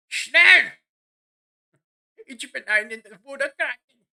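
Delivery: loud mock German shout; fake foreign language. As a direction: bark it like a cartoon German. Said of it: "that's not German".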